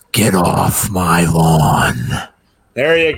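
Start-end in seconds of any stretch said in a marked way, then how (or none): 0.1-2.0 put-on low, slow grumpy old man growl. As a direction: bark it like a cranky old man. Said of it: "You got the old man voice".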